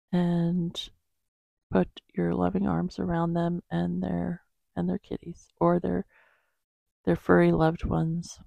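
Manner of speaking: soft, unhurried praying voice; quiet prayer. Acted. Praying aloud for animals and the world, soft and halting. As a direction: pray gently, pausing to find the words.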